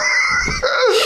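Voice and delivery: a shrieking burst of laughter; high squeal of laughter. Real, not acted. Still laughing at the supermodel line, shrieking laughter. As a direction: let the laugh explode.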